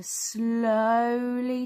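slow, lingering "slowly"; "slowly" said long and slow; drawn-out word. A narrator's reading. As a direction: stretch "slowly" right out. Word drawn out to match meaning.

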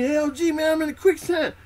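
high, loud, frightened cry across the jungle; panicked yell for help. Retold or imitated. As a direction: yell like a scared recruit sinking. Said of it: "he yells over me".

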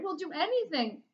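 high desperate promise; frightened kids pleading. A narrator's reading. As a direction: beg them to agree. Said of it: "the boys cried".

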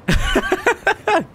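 high-pitched burst of laughter. Real, not acted. Player laughing at being bullied by the game, high laugh.

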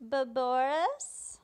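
stammered, drawn-out "But Boris?" climbing at the end; questioning. Retold or imitated. She faces a changed Boris, hesitant questioning. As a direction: stretch "Boris" slowly into a rising question. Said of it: "Question mark".

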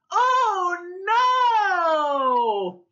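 high, loud mock "oh no"; pretend alarm. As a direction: cry out in playful shock. Pretend shock at whale.

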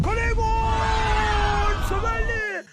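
high, slow, drawn-out goal scream; screaming football commentator. Real, not acted. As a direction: scream the goal call like live TV. Goal scored in football game.

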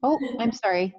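normal talking voice

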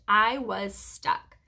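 usual speaking voice

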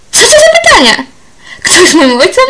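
loud, high, exploding in anger; furious outburst. Acted. Annabel explodes at the old man asking about her father, furious. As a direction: snap back, outraged by the question.

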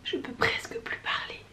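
breathy, voiceless, barely there; hoarse whisper. Real, not acted. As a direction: whisper it like the voice is gone. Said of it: "On peut le dire que".

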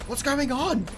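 sharp high startled question; surprised. Real, not acted. Streamer reacting to the game, startled and high. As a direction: blurt it out, caught off guard.

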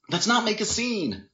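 high, urgent appeal; placating boyfriend. A narrator's reading. Boyfriend grabbing her arm, urgently placating. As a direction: plead to stop, pitch up.

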